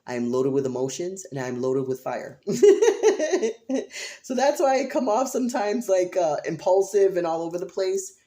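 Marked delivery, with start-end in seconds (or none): 2.5-4.2 big high burst of laughter; loud high laugh. Real, not acted. Joking about her fiery chart, a loud laugh. 4.2-8.1 louder, higher words through laughter; laughing. Real, not acted. Explaining her impulsiveness to viewers, laughing.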